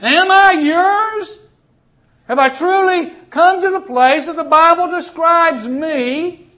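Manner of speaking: high, loud cry to God, a laugh at the start; loud pleading prayer. Acted. Preacher voicing a sinner asking God if he is saved, loud plea. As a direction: plead with God, drawing out am I yours.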